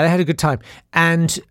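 usual speaking voice

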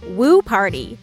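ordinary delivery

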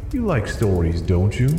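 ordinary delivery

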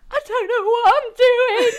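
high, loud wailing imitation of a crying friend; mock crying. Retelling how the high friend cried in bed, mock wailing. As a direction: wail it out high like she's sobbing. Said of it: "like, crying".

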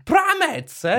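high, loud doubting mum. Pretend. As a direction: scoff like a fussy mum. Mum role continues.

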